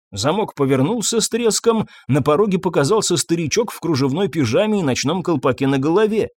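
ordinary delivery, nothing marked

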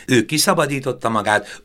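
ordinary delivery, nothing marked

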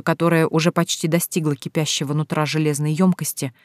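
plain reading voice

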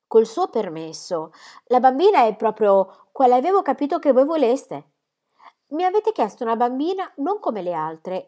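smooth things over eagerly; pleading aunt. Acted.

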